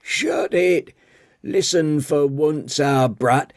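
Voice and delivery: put-on wag a finger like a cranky old tea lady; scolding old woman. Old tea lady scolding Daniel for whinging, high old voice. Gwyn speaks of father.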